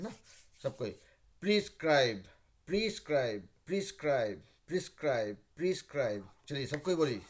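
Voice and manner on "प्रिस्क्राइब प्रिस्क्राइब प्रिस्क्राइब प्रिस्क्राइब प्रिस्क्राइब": प्रिस्क्राइब repeated five times, pris-kraib with a falling end; pronunciation drill. Shown as a demonstration. Teacher drills the word five times for students, steady. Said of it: "इसको हम लोग पांच बार pronounce कर लेते हैं".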